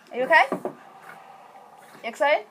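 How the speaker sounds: high, rising doting questions; pet talk. Real, not acted. Owner checking on her excited dog, high doting questions.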